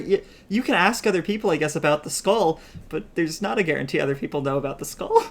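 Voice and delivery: ordinary talk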